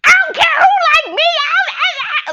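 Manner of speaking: high, loud, fast mock scream; imitating a scream. Sherelle screaming back at Irena, loud mock shriek. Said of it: "was screaming and yelling at Irena".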